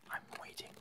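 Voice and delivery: ordinary talk, nothing marked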